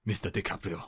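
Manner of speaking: deliberate mock accent, Swedish sliding into Chinese; stern refusal in a badly faked Swedish-Chinese accent; Greta Thunberg. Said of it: "she sounds Chinese".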